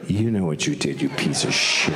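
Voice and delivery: very low, accusing growl of authority; menacing church. Retold or imitated. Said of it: "the church is like".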